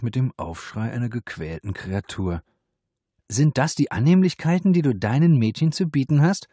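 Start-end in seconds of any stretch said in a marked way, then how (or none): 3.3-6.5 mocking. A narrator's reading. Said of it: "höhnte die Polizistin".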